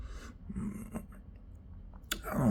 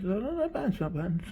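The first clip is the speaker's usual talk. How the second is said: high, laughing mock wail; mock protest; 9 semitones higher, 3 dB quieter than usual. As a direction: whine it through laughter.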